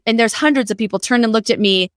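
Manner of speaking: ordinary delivery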